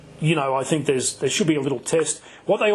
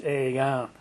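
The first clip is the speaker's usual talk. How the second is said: nasal Aussie greeting run into one word; slurred-together Australian accent; broad Australian accent. Shown as a demonstration. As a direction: say it through the nose, out the side of the mouth, very Australian. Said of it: "mostly through your nose and out the side of your mouth".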